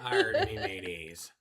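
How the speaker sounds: slow pirate growl. Pretend. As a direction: drawl it like an old sea pirate. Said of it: "I wanna talk like a pirate".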